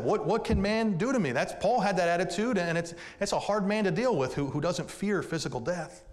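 impassioned pulpit voice; full-force preaching. Acted. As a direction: drive the verse home to the congregation.

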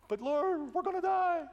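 high, panicked wail; mock panic. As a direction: play the frightened disciple, hammed up. Disciples cry out in panic.

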